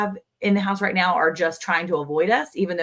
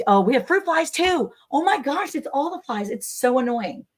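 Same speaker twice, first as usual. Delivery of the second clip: high-pitched fussy complaint; exaggerated exasperated whine; mock exasperation; 7 semitones higher than usual. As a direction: act out being fed up, pitched up. Acting out her fly complaint.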